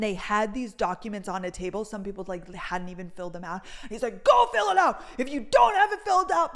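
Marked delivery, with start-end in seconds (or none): 4.2-6.5 high, loud harsh orders; guard yelling. Retold or imitated. Guard yelling at people who hadn't filled out forms. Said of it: "these poor people just getting yelled at".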